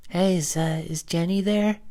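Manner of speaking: high, hesitant phone voice; timid caller. Retold or imitated. Kid calls Jenny's house and asks for her, hesitant high voice. Boy phones girl's family.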